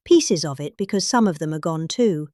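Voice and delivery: normal talking voice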